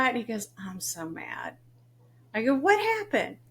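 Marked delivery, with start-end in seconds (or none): 0.6-1.6 whispered, voiceless grumble; hushed complaint. Retold or imitated. Son whispering to mom he is mad about his promotion. 2.5-3.4 high, startled "What happened?"; alarmed question. Retold or imitated. Mom startled when her son says he is mad.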